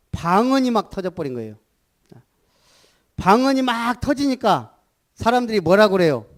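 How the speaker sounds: ordinary talk, nothing marked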